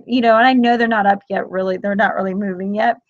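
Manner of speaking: ordinary talk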